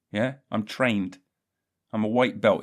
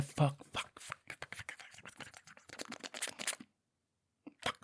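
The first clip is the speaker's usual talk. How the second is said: curses fading to a whisper; fading swearing; 5 semitones higher, 12 dB quieter than usual. Acted. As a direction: let the swearing die away to nothing.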